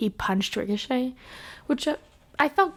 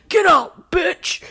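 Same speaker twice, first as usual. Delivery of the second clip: mock yell; 7 semitones higher, 7 dB louder, much slower than usual. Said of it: "And Brock would be like".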